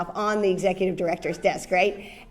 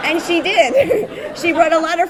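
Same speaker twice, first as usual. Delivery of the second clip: higher, louder, slow line broken by laughter; laughing; 8 semitones higher, 8 dB louder than usual. Real, not acted. Telling how Jane Fonda brought friends, laughing. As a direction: laugh as you deliver the payoff.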